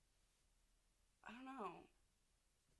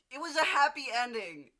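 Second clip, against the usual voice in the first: emphatic; 5 semitones higher, 12 dB louder than usual. Real, not acted. Emotional about ending.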